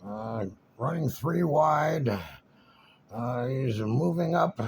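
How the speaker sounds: lightly voiced, slow race call; a hint of a track announcer calling it. Mimicking the track announcer calling the race, lightly. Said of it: "announcers on there".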